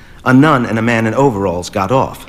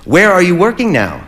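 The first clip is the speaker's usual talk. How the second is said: loud enough to be heard; 6 semitones higher than usual. A narrator's reading. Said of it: "in a voice loud enough to be heard".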